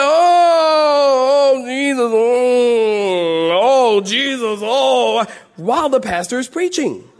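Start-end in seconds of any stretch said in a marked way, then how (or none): 0.0-5.3 high, loud wailing moans of church ladies; hammed-up moaning cry, higher and louder; mock moaning. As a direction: wail it out like a church lady on the bench. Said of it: "They would just moan, and be like".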